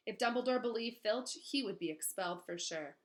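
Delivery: plain reading voice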